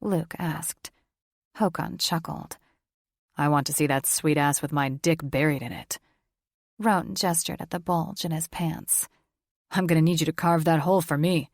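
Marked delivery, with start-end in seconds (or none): 3.4-6.0 low, husky-free lustful male voice; a hint of a crude brother leering; lightly voiced crude brother, low. A narrator's reading. 9.7-11.5 fast, louder crude male voice; a hint of a crude brother, fast; lightly voiced brother. A narrator's reading.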